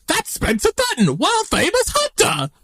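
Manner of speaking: high, hammed-up British accent; excited English accent. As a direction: put on an over-excited English commoner's accent. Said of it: "Where is that person from?".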